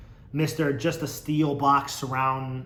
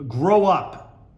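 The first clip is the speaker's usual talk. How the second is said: louder quoted retort; mock scolding; 3 semitones higher, 9 dB louder than usual. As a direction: call it out like a fake telling-off. Reading a commenter's retort.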